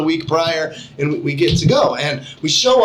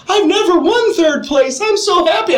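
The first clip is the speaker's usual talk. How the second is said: put-on little kid voice; 13 semitones higher than usual. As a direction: gush it like an eight-year-old who just won. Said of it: "this little seven, eight-year-old kid".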